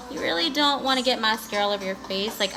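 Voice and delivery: ordinary talk, nothing marked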